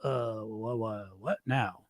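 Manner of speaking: low, hushed and slow, mock bewildered; dazed dieter. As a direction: mumble it like someone stunned and lost. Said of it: "you're like".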